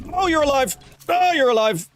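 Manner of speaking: high-pitched startled cry. Real, not acted. Player finding an enemy alive in the game, startled. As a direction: jump and blurt it high.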